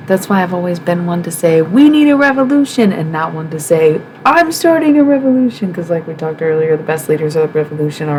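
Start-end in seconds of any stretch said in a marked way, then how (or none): 1.6-2.9 high, loud mock rally cry. Imitating a protester rallying the people with a loud, high cry. 4.2-5.7 high, loud mock boast; self-appointed leader. Imitating a self-declared leader announcing a revolution, high and loud.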